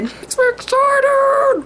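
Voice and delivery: high, slow, squealy sing-song; squealing excited. Pretend. Vlogger squealing over her new red hair. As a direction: squeal it out like a giddy kid. Giddy repeat of own line.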